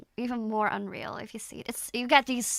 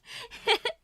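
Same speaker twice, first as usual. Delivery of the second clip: high giggle; 11 semitones higher than usual. Real, not acted. Giggle after teasing her mom.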